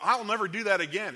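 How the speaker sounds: earnest vow. Acted. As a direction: swear it out loud to God. Believer's promise to the Lord.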